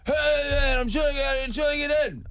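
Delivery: high-pitched imitation of a drunk stranger; mock plea to be let in; man at door.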